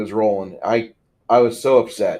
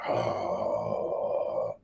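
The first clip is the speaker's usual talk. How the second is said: long, low, creaky "oh"; sinking mock groan; retold dismayed groan; 13 semitones lower, 10 dB quieter than usual. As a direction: let out a drawn-out groan of realization, very low and soft. GM reacts to revelation, retold.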